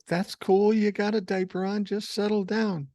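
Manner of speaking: retold parent trying to settle a kid down; placating dad.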